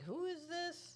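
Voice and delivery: high-pitched, demanding who is this; baby mama confronting the stranger, high and indignant. Retold or imitated. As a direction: pitch it way up like an outraged ex. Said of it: "baby mama's is like".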